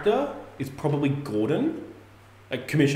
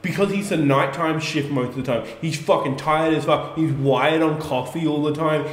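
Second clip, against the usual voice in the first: ordinary talk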